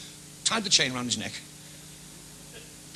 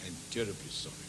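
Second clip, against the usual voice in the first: lower, much softer posh apology; terribly polite apology; 10 semitones lower, 13 dB quieter than usual. Acted. Blonde fella apologising to the little man, soft lower posh voice. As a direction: murmur it like an embarrassed gentleman.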